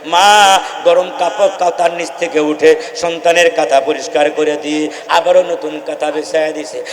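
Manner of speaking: ordinary delivery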